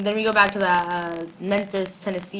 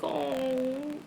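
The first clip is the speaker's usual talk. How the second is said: high, soft, drawn-out coo; smitten aww; 4 semitones higher, 10 dB quieter than usual. Retold or imitated. Vlogger swooning over the cutest thing this season, smitten aww. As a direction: let out a small melting sigh of adoration.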